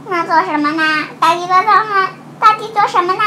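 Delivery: building urgency. Acted. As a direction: push harder on each question. Building question in story.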